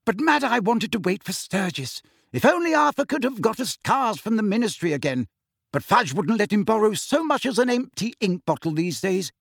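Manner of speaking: fretful mum, lightly voiced; motherly voice. Mrs Weasley voiced.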